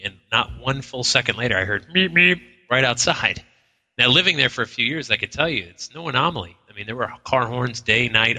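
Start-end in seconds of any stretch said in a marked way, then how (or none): 2.1-2.4 horn imitation. Imitating the car horn outside.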